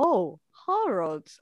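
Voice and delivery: drawn-out British pronunciation of Harrods; slow mock-British correction; British accent. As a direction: imitate a British local, stretching the vowels slowly. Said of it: "and they're like".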